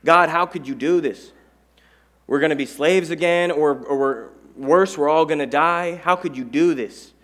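loud, pleading cries of a trapped crowd; mock panicked crying out. Israelites crying out to Moses, loud and desperate. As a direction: wail it like a people with nowhere to run. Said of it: "they're crying out to Moses".

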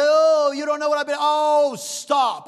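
loud hammed-up whine cut off by a sharp stop; mock the excuse, then shut it down; mock whiner. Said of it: "I've had people say".

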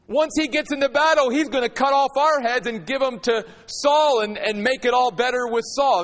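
half-acted fury at a gullible king; furious leaders. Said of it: "became angry".

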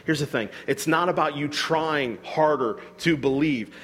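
normal talking voice

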